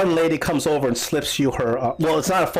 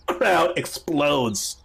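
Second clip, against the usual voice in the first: ordinary talk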